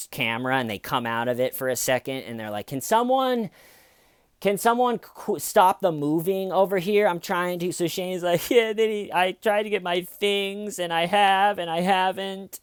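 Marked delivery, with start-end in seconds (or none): 2.7-7.7 high, annoyed actor breaking character; mock put-out performer, pitched up; interrupted actor. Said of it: "like an actor that's in the middle of a scene". 8.5-12.6 high imitation of faked distress; badly faked distraught caller, pitched up; mock distraught. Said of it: "in the distraught tone of voice".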